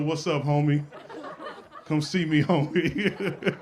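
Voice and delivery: ordinary talk, nothing marked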